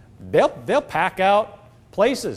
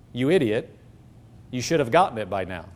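low, fast accusing voice of the enemy; a hint of the enemy berating you; voicing the enemy; 8 semitones lower than usual. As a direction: lightly play the accuser, dropping much lower. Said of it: "That's, that's the enemy".

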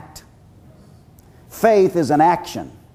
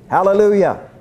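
normal talking voice

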